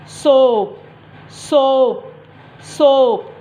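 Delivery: सोप said with a long o, the a silent; सोप drawn out clearly; modelled word. Shown as a demonstration. Teacher repeats सोप for the children, slow and clear. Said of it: "जब हम इसको read कर रहे तो क्या पढ़ रहे हैं".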